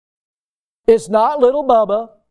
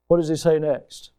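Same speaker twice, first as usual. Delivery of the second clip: normal talking voice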